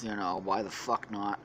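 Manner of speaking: ordinary talk, nothing marked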